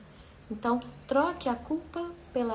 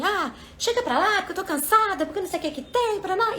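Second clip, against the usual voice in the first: higher, louder, irritated yell; mock shout; 9 semitones higher, 4 dB louder than usual. Someone tired yelling to be left alone, loud mock shout. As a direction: snap back like a tired, annoyed person. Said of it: "alguém gritou comigo".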